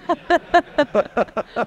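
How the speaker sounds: a high burst of laughter; bright hearty laugh; real laugh. Real, not acted. Laughing at his own story.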